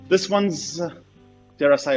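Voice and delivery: normal talking voice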